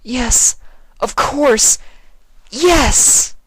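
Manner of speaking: very high, loud rapturous cry; ecstatic yes. Acted. Twilight answering Sympathy, very high loud rapture. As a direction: surrender in joy.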